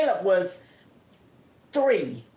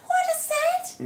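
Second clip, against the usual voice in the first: high, startled question; spooked high squeak. Retold or imitated. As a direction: gasp it out in high surprise. Scared at the grandfather clock.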